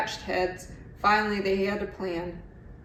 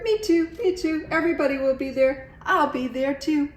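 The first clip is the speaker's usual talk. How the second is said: high, louder excited chatter; eager me too; 6 semitones higher, 4 dB louder than usual. A narrator's reading. Iguana eager to join the party, high excited chatter. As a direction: bubble over with excitement.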